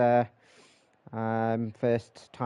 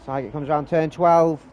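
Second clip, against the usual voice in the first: brisk live play-by-play call; race commentary; 5 semitones higher than usual. Real, not acted. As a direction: call the qualifying laps as they happen.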